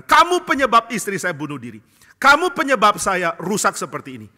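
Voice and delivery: high, loud furious blaming; angry accusation. Retold or imitated. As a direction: hurl the blame at the devil father. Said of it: "Lalu orang ini marah dan mengatakan". (menuduh dengan marah, tinggi dan keras)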